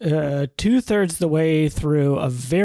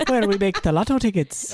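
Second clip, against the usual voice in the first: put-on Irish accent; 6 semitones higher than usual. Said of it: "Irish accent".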